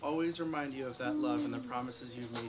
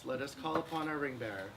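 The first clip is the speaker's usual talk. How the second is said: ordinary talk, nothing marked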